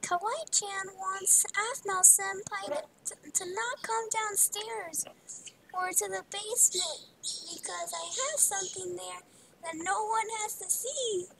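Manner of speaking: high, cutesy character voice; put-on high voice, sweetly bossy; Kawaii-chan. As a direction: do a high-pitched cutesy impression. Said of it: "an amazing impression of Kawaii-chan".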